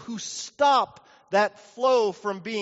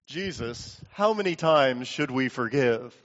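low, smug aside, sure of the question; understated cockiness, pitched down; a little bit cocky; 8 semitones lower than usual. Acted. Peter stumping Jesus before the disciples, a little cocky. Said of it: "little bit cocky".

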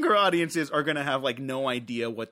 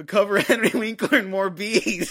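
louder, laughing mid-line; laughing through quote; 7 semitones higher, 7 dB louder than usual. Retold or imitated. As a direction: crack up while quoting the line. Quoting cameo, cracking up.

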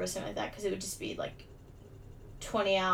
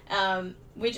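normal talking voice

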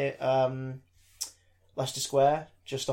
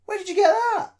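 surprised question; 5 dB louder than usual. Retold or imitated. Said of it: "and he says, like".

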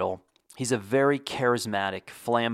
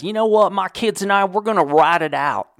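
high, loud, cocky local voice; defiant hurricane stayer; 9 semitones higher, 7 dB louder than usual. Retold or imitated. Hurricane stayer tells the reporter he won't leave, cocky and loud. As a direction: brag to the reporter that you're staying put.

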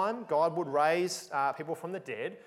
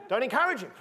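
louder, higher, laughing as he says it; laughing plea; 7 semitones higher, 6 dB louder, faster than usual. Retold or imitated. Preacher recalls telling laughing women not to encourage Celsus, laughing. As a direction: laugh through the playful scolding. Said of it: "I said".

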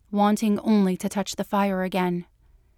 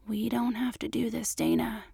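much softer hushed whisper; 7 dB quieter than usual. A narrator's reading. Mulder to Dana in the cab, tender whisper. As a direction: whisper it so the car can't hear. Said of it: "he whispered".

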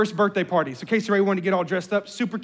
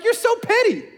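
high, exasperated jab at the daughter; 12 semitones higher than usual. Retold or imitated. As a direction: toss it off, fed up and incredulous. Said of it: "I'm like".

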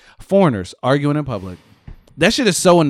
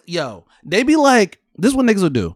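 ordinary talk, nothing marked

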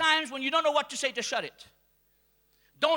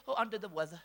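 feeble sick voice; 7 semitones lower, 9 dB quieter, faster than usual. Retold or imitated. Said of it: "You say".

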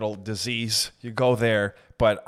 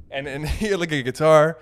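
ordinary delivery

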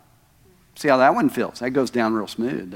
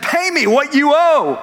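much higher and louder, slow, angry bark; throttling demand; 12 semitones higher, 5 dB louder than usual. Acted. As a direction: choke the debt out of him. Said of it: "took him by the throat and he said".

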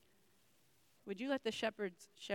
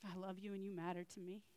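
much softer intimate words; soft God's voice; 10 dB quieter than usual. Acted. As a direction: speak it softly into their ear. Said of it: "allow God to whisper into your ear".

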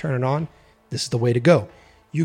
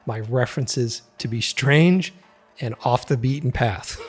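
ordinary delivery

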